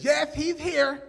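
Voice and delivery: high-pitched little teacher voice; put-on high, prim reply; Miss Maddox. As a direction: imitate a small teacher answering the office. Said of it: "It was this little... Miss Maddox".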